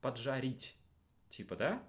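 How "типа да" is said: ordinary delivery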